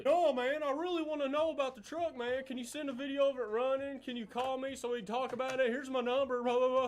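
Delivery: high, loud impression of an eager kid; mocking pestering fan. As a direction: pester excitedly, pitched well up. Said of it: "asking questions like".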